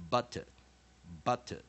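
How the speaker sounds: "butter" said "BOT-uh", reduced second vowel; weak-vowel drill. Shown as a demonstration. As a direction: model "butter" as "BAH-tuh", firm first beat. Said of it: "weak syllable".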